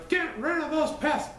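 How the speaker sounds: high, squeaky storybook shout of the friends. A narrator's reading. Friends shout at Figwit to drop the pests, high cartoon shout. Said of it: "All Figwit's friends shouted".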